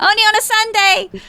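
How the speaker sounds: high, loud comic yell; shouted retort. Acted. Fleeing woman answers his jibe, shouted retort. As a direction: yell the comeback from a distance.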